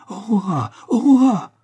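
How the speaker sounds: alarmed cry. A narrator's reading. John cries out to his wife, horrified and loud. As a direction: call out to stop her, horrified. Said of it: "s'écria-t-il".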